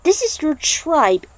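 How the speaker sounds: pleading, high-pitched and urgent; anxious young woman. Acted. Aiko anxiously questions Nightwolf about his tribe, high and urgent.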